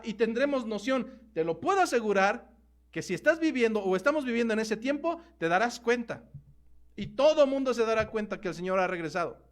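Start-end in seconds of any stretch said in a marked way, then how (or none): none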